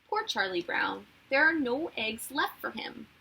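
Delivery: usual speaking voice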